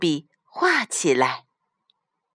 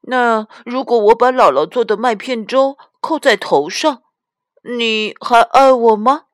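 put-on higher, louder child voice, drawing out the question; little daughter; 6 semitones higher, 6 dB louder than usual. A narrator's reading. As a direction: test mum like an eager little girl. (小女儿提高嗓门追问妈妈)

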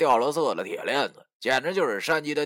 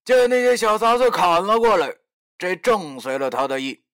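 usual speaking voice

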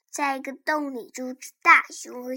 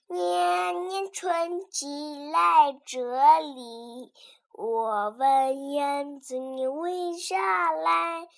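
slow sing-song recitation; poem recitation; 3 dB louder, much slower than usual. Acted. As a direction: recite each line slowly. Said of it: "给大家背一首诗".

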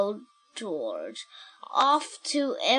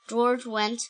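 plain reading voice

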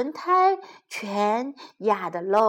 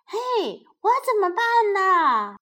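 high, put-on hen's wail; high-pitched fretful hen voice; clucking hen; 10 semitones higher than usual. A narrator's reading. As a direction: cry out high like a left-out hen. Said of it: "母鸡希拉里咯咯咯叫了起来".

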